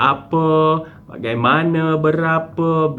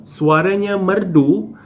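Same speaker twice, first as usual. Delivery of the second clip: "Suaranya merdu" slow and higher, rising on "merdu"; question intonation; 5 semitones higher, 3 dB louder, slower than usual. Shown as a demonstration. Teacher reading the second example, question intonation rising on "merdu". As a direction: ask "Suaranya merdu" slowly, lifting "merdu". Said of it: "ayat penyata dengan tanda tanya pada akhir".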